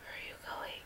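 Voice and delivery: trailing off into a whisper; half-voiced whisper to the screen. Real, not acted. Same question, plain then whispered.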